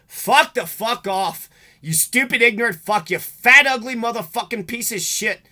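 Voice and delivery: angry shout. Real, not acted. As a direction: spit out the insults in rage. Furious rant at abusers.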